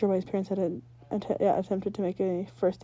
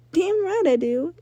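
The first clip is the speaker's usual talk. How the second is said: slow, loud, higher and cocky; proud; 9 semitones higher, 7 dB louder, slower than usual. Acted. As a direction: savour the boast. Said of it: "Ember said proudly".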